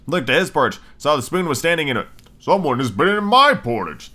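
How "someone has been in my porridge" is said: lightly voiced middle-sized bear; a hint of an indignant bear character. Middle-sized bear finding a spoon in the porridge, middle-sized voice. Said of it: "in a middle-sized voice".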